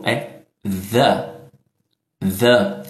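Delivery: "the" with the tongue between the teeth, voiced; weak th demo. Shown as a demonstration. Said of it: "mais fraco, como em the".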